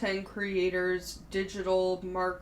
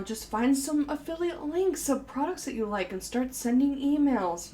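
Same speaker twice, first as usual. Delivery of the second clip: higher, hammed-up salesy pitch; mock marketer voice; 7 semitones higher, 3 dB louder than usual. Mocking the emails that marketing courses send out, hammed up. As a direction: parody a glib online marketing guru.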